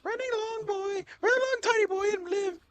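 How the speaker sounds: very high taunting voice; mocking high voice. Player taunting a fleeing animal in a high voice.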